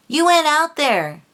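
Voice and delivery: high, loud accusation, falling at the end; pissed off. Real, not acted. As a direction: angrily call them out, pitch jumping up. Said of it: "this stuff has really pissed me off lately".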